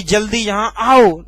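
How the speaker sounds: आओ punched at the end, slowly; stress on आओ. Shown as a demonstration. Teacher showing the wrong way, only आओ stressed. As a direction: save all the force for आओ. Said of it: "ऐसा नहीं".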